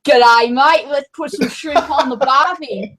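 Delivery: put-on a jokey stock Australian accent. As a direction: lay on a mock Australian accent like a tourist ad. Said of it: "if I do it in an Australian accent".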